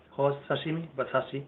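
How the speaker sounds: usual speaking voice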